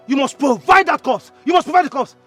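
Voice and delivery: loud, insistent repeated demand; angry demand. Acted. Brother demanding the corpse for burial, angry demand. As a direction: hammer it out loudly.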